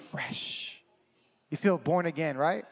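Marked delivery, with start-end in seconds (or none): 0.1-0.8 whispered, barely a sound; hushed relieved whisper; breathy whisper. Acted. Describing feeling after prayer.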